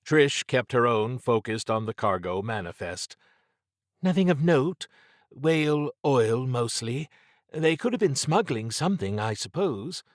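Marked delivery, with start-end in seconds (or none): 4.0-10.0 woman's voice. A narrator's reading. Trish's quoted answer.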